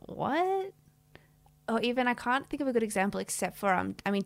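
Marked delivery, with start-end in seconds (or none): 0.1-0.7 surprised. Retold or imitated. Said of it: "you're like".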